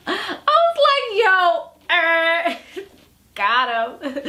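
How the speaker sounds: laughing through the words, pitched high; real laughter at the comeback. Real, not acted. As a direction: crack up while saying it.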